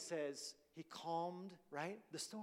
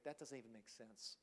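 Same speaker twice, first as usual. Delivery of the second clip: much softer, deadpan matter-of-fact; dry retort; 8 semitones lower, 13 dB quieter than usual. Retold or imitated. Jesus answering the rulers, soft deadpan retort. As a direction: toss it off, almost amused.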